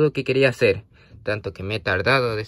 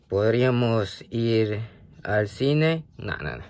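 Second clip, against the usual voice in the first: normal talking voice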